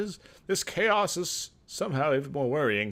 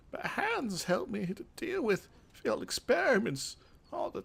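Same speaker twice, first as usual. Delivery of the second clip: put-on higher, breathy-free old craftsman speaking in character; craftmaster's voice; 7 semitones higher than usual. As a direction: play the craftmaster, lofty and a bit condescending. Voices Nicodemus reading his lines.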